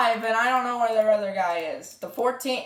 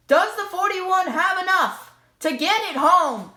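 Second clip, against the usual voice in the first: higher, driving play-by-play; motorsport commentator; 5 semitones higher than usual. Acted. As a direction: build the tension of the final lap.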